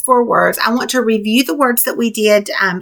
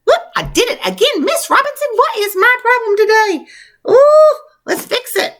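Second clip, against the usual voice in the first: much higher, playful oops voice; hammed-up self-scolding to the class, much higher; mock dismay; 12 semitones higher than usual. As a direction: squeal at your own mistake, playfully. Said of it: "Even Miss Robinson messes up".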